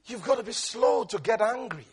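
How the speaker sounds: ordinary talk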